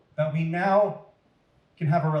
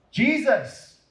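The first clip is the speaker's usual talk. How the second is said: joyful recognition; 8 semitones higher, 4 dB louder than usual. Acted. Mary recognises Jesus.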